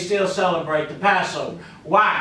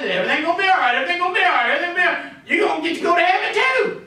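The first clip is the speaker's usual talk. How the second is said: high, repetitive mock reassurance; hammed-up insistent optimism, pitched up; positive thinker; 9 semitones higher, much faster than usual. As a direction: chant it like a frantic optimist. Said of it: "one of them guys".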